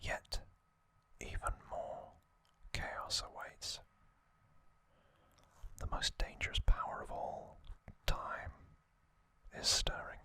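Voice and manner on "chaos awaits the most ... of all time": ordinary talk, nothing marked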